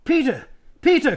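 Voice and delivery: high, loud calls up the slope; eager yell across the gorge; urgent cry. Acted. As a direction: shout for his brother. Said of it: "cried Edmund".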